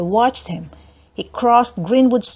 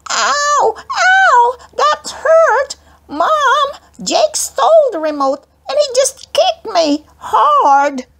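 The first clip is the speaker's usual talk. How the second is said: much higher, louder, crying yell; little sister crying out; 4 dB louder, slower than usual. A narrator's reading. Little sister howling to mom after a kick. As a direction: wail like a hurt little girl. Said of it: "Abby yelled".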